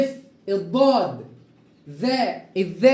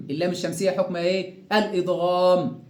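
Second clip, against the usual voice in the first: ordinary delivery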